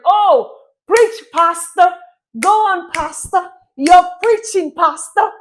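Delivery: egging on the pastor with loud joy; excited church amen. Retold or imitated. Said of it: "You remember in church".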